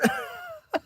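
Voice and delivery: high short laugh; real laugh. Real, not acted. Storyteller after his yelled answer, real laugh. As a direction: laugh at your own story.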